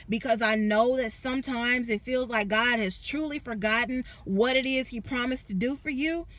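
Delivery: ordinary talk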